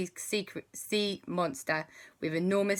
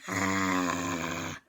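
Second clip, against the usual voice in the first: 1 monster roar; 11 semitones lower than usual. Acted. Sea monster screaming.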